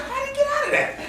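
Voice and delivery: high, startled squeak of disbelief; baffled surprise. Retold or imitated. Dog owner spotting the escaped dog, stunned high disbelief. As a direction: gasp it out like you can't believe your eyes.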